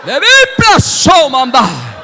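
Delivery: high, slow, loud cry falling at the end; ecstatic shout. Real, not acted. Preacher crying out amid congregation's prayer, ecstatic shout. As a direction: cry out in drawn-out bursts.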